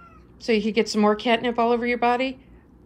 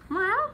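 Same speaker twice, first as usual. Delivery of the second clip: mimicked meow; 12 semitones higher than usual. Meowing back at the cat.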